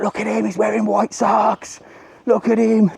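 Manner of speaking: high, jeering playground taunt; mocking schoolkid taunt. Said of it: "Be like".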